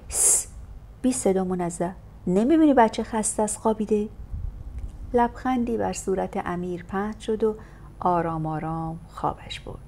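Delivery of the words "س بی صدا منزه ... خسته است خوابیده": ordinary delivery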